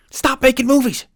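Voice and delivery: high, loud yell at Hollywood; shout. Real, not acted. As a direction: scream it at the studios. Shouting at the film industry.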